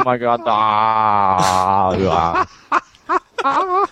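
slow wail breaking into a squeak; drawn-out mock scream. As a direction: ham up a long anguished cry. Acted scream in silly sign-off.

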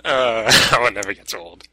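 ordinary talk

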